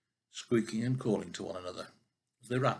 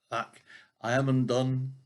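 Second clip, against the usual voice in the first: despairing cry; 2 semitones higher, 7 dB louder than usual. Acted. Tailor despairing.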